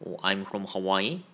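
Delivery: ordinary talk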